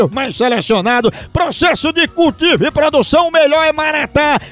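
upbeat radio commercial voice; ad read. Acted. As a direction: sell the coffee with energy. Live coffee ad read. (locução de comercial animada)

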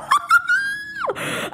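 very high, drawn-out squeal; excited squeal. Real, not acted. Screams at the video.

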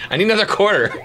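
put-on pestering kid. Kid back at parents after one minute, pleading. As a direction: come back pleading like a child. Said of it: "like, one minute and come back".

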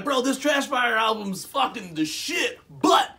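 high, loud, drawn-out hype; drunk guy's rant. Retold or imitated. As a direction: imitate a worked-up drinker proclaiming his verdict. Retelling a drunk guy's boast.

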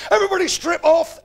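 high, loud barked order; sergeant's shout. Retold or imitated. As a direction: bark it like a drill sergeant.

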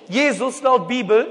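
usual speaking voice

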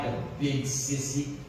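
put-on a little girl in the dark, barely speaking; small, shaky voice. A narrator's reading. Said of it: "a small, shaky voice".

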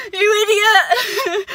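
slow, tearful half laugh; laughing through tears. Real, not acted. [name] teasing herself through tears, laughing and crying. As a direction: scold yourself while laughing and crying.

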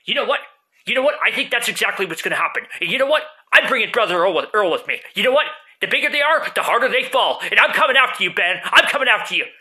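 loud, high fighting threats; furious challenge, shouting; arguing with myself. Acted.

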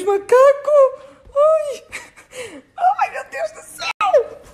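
high, loud squeals of fear with laughs; real panicked squealing; frightened shrieks. Real, not acted. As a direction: shriek at the monkey in the room. Monkey in the room.

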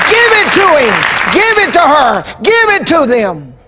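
high shouted cry fading down; repeating the judge's verdict to the church, from a shout down to calm; preaching refrain. Acted. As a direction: start high and strong, then ease off each time.

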